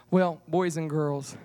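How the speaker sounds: normal talking voice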